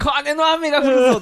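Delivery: loud, high ring proclamation; wrestler catchphrase shout. Retold or imitated. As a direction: roar it to the crowd. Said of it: "とかって". (決め台詞を叫ぶ)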